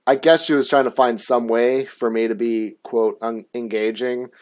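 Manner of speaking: usual speaking voice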